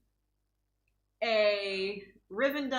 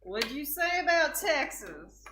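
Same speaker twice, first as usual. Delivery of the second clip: louder, higher, drawled challenge; defensive Texan; 5 semitones higher, 4 dB louder than usual. Pretend. Texan woman mock-defending her home state, drawled challenge. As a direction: bristle like a proud Texan.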